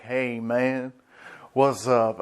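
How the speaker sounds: casual, easygoing character greeting; laid-back admiral. Retold or imitated. Borsalino greeting the scientist, laid-back.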